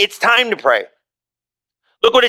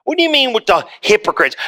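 ordinary talk, nothing marked

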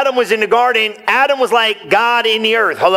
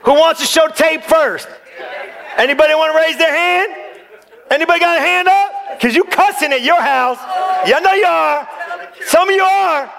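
playful teasing; 7 semitones higher than usual. Real, not acted. Teasing the congregation, laughing.

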